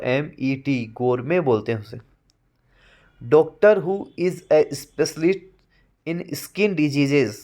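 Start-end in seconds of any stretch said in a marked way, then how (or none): 3.2-7.3 slow, deliberate reading of a definition; slow dictation. Shown as a demonstration. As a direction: read it slowly so students can write it down. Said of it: "dictates definition".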